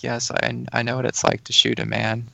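John DuPont impression. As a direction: imitate his eerie gentle way of talking. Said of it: "that's how, like, he would talk".

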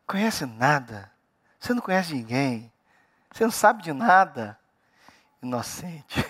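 low, unhurried put-down; dry, subdued scolding; dismissive rebuttal. Real, not acted. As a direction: deflate them quietly, with pauses between phrases. Deflating the know-it-all.

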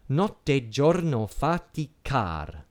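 "faticar" with a long, weighted last syllable CAR; slow Italian quote, landing hard on the end of "faticar"; stress on the final syllable. Shown as a demonstration. Lecturer shows students an accent on the final syllable, end-stressed "faticar". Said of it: "accent on the final syllable".

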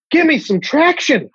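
high, pleading mock voice; mock plea. Comic to podcast host, begging to be cancelled, high mock plea. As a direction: squeal it out as a fake plea.

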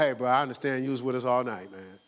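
a hint of a soothing church friend; mock sympathetic friend. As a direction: ease him off, like a buddy. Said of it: "you ain't gonna".

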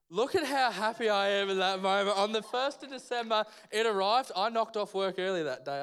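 loud, high-pitched burst of delight; joyful excitement. Real, not acted. Preacher telling the congregation his order finally arrived, joyfully excited. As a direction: shout the happy news out to the room. Happy climax of his story.

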